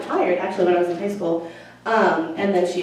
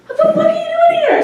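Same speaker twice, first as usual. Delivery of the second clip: much higher and louder, laughing disbelief; shocked exclamation; 8 dB louder than usual. Retold or imitated. Counselor spotting her old student in the hallway, shocked and squealing.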